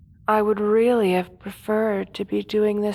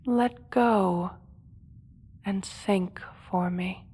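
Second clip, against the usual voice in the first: hypnotic whisper; 4 dB quieter than usual. Acted. Hypnotizing the guard.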